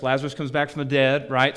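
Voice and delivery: normal talking voice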